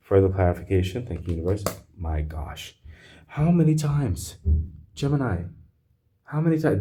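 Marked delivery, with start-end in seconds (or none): 3.3-6.8 can't believe the cards again; exasperated disbelief. Real, not acted. Reader to Gemini, high exasperated disbelief.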